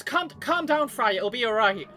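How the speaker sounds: loud, high hammed-up comfort; calm her down theatrically; mock reassuring. Character soothing the screaming Inkling, hammed-up loud reassurance.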